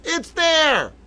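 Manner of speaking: sudden high exclamation of relief; excited. Real, not acted. Finally seeing the article load, excited high call. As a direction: it finally loaded, yelp it out.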